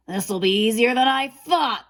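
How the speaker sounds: snarled. A narrator's reading. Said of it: "the wolf snarled".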